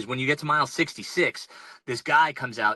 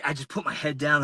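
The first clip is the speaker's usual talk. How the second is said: ordinary talk, nothing marked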